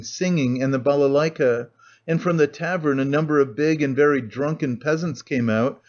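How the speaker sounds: ordinary delivery, nothing marked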